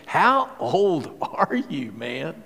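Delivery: slow, incredulous Pharaoh voice; a lightly voiced astonished Pharaoh. Shown as a demonstration. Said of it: "it's something like this".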